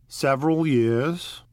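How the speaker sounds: put-on slow, pleased old gangster; gratified. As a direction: savour the memory. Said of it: "he answered in a gratified way".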